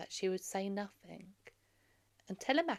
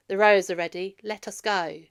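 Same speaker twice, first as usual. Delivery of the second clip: calling out; 12 dB louder than usual. Acted. Athena calling Telemachus out.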